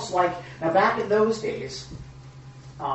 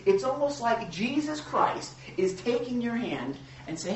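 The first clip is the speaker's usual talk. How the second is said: ordinary talk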